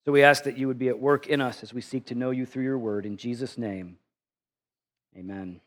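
soft, low closing prayer. Acted. Pastor praying over the congregation, softly. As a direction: pray it quietly, winding down to amen.

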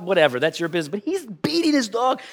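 ordinary talk

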